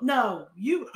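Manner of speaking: ordinary delivery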